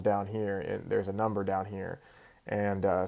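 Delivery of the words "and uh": ordinary talk, nothing marked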